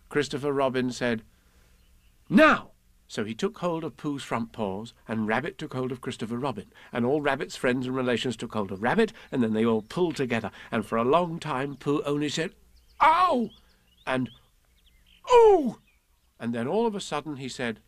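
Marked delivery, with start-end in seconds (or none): 2.3-2.7 high, loud "now!" to start the pull; loud call. Acted. Christopher Robin calls the pull. 13.0-15.8 high loud "ow" and "ooh"; pained yelps. Acted. Pooh being pulled out.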